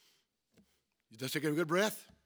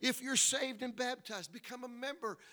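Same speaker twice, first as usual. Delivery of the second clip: forceful preaching; 7 semitones higher than usual. Real, not acted. Preacher urging members to serve in church, forceful preaching. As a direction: drive each command home, rising to a peak. Exhorts congregation at full force.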